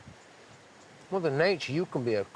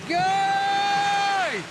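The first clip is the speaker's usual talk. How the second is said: excited shouting; 12 semitones higher, slower than usual. Real, not acted. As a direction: yell to keep everyone paddling. Rowing to avoid the rocks.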